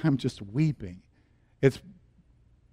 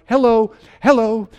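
raised, higher call for attention; 8 semitones higher, 6 dB louder than usual. Acted. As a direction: call out for someone to respond. Father calling for absent medical staff.

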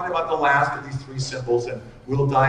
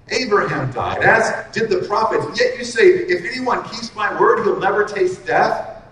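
raised, higher, louder mock accusation; indignant accusers; 5 semitones higher, 5 dB louder than usual. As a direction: throw the challenge at him, heated.

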